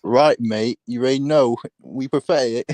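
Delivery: high, loud Australian put-on; mock Australian accent. Friend speaks as the Australians, mock Australian accent. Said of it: "it was the Australians who perfected it".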